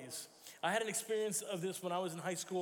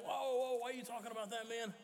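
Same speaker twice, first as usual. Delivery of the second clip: higher, hushed mock-spooked passerby; higher, hushed startled protest; mock startled local; 5 semitones higher than usual. Italians recoil again.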